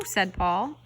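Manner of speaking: ordinary delivery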